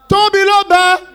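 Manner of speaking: high, loud imitation of mum nagging her son; shrill mom voice. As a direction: squawk it like a nagging mother. Mom's voice heard in head.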